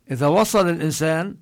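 normal talking voice

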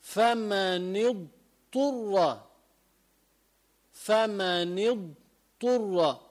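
فمن اضطر very slowly with a clear ḍād then ṭā'; ḍād before ṭā'. Shown as a demonstration. Teacher models ḍād followed by ṭā'. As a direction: draw out اضطر so both ḍād and ṭā' are heard. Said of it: "تأتي بعدها الطاء".

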